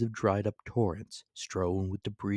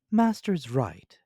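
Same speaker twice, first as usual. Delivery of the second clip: Counsel's voice; 7 semitones higher, 7 dB louder, slower than usual. A narrator's reading.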